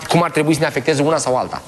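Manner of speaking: ordinary delivery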